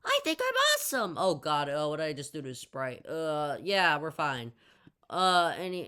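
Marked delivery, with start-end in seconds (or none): 0.1-1.2 put-on very high, loud, fast kid brag; tiny kid bragging to the crazy kid, very high and fast; little kid voice. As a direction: squeak out a boast like a cocky tiny kid. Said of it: "He's like".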